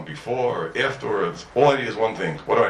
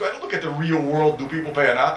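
ordinary delivery

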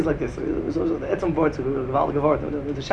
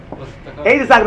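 ordinary talk

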